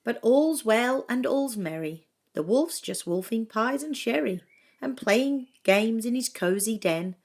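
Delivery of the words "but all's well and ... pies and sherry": ordinary delivery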